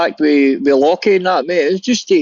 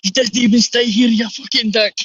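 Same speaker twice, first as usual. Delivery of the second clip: pitched-up mocking jeer; imitating the lads laughing at the man they fooled; 7 semitones higher than usual. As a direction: jeer at the mark like the wind-up lads. Mates mocking wind-up victim.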